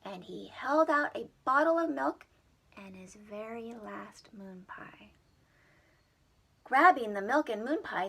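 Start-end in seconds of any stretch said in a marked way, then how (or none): 2.8-5.1 soft whisper. Acted. Dropped whisper.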